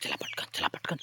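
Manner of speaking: hushed unvoiced urging; whispering while hiding. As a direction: whisper so nobody hears. Sneaking up to steal mangoes.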